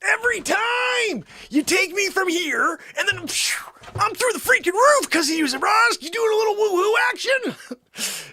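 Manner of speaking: high-pitched comic ranting; mock outrage. Host complains to Zabrosk about the woohoo, mock outrage.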